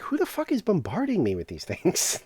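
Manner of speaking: high-pitched, playful exasperation; mock indignation. Player reacting to a flood of invites, mock indignation. Acting own annoyance at invites.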